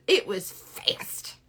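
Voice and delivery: slow, whispered punchline. Real, not acted. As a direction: whisper it slowly. Coffee story.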